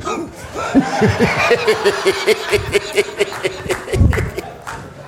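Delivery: breathy laughter at own joke; hearty laugh. Real, not acted.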